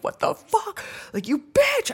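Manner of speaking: very high, squealed disbelief; acted shock. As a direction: squeal it in mock outrage. Acting shocked reaction to find.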